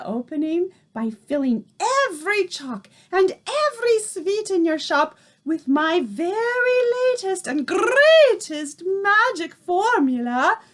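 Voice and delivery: Grand High Witch unveiling her magic formula; accented witch. A narrator's reading. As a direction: present the formula with proud menace. Holds the witch voice.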